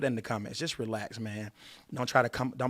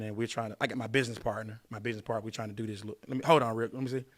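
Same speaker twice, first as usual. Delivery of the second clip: normal talking voice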